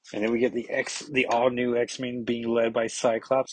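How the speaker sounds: normal talking voice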